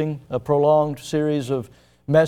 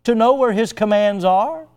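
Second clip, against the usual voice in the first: raised, high, loud sermon cry; preaching at full force; 8 semitones higher, 6 dB louder than usual. Real, not acted. Preacher pressing the congregation, loud and high. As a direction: drive the questions home to the congregation.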